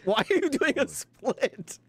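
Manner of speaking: laughing. Real, not acted. Laughing at suspect's pose.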